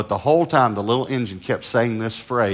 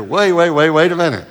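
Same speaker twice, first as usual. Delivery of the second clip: excited interruption; 7 semitones higher, 7 dB louder than usual. Retold or imitated. Startled, stopping his wife.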